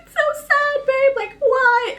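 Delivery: very high, whiny mock sobbing; imitated crying. Wife telling husband why the book upset her, mock crying. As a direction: act out a tearful, squeaky wail. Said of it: "I was crying, you guys".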